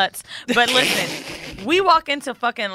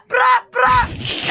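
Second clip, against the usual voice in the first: high, loud mock yell of a greeting; 14 semitones higher, 6 dB louder than usual. [name] bursting into the bar with a loud mock yell.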